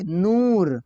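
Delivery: नूर with a long, slow oo; drawn-out vowel. Shown as a demonstration. Teacher giving learners a waw maroof example, drawn out. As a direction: stretch the oo in नूर. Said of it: "वाव मारूफ को हम खींच के पढ़ते हैं".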